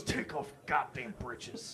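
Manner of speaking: low, quiet drawl; low Texas drawl. Retold or imitated. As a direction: growl it low and soft. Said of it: "he immediately goes".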